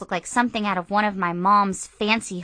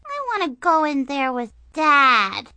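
pouting little sister; 7 semitones higher, 5 dB louder than usual. Acted. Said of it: "Brianna started to pout".

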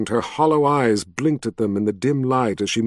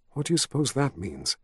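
hushed, much softer question; whispered; 8 dB quieter than usual. A narrator's reading. Woman whispers as if the dead might hear, hushed. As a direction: ask it under your breath. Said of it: "she whispered".

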